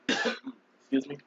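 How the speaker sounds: usual speaking voice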